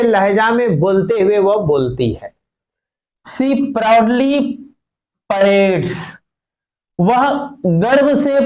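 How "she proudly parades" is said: very slow, word by word; drawn-out reading of each word; slow dictation. Shown as a demonstration. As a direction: spell it out slowly for the class. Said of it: "teacher reading text slowly".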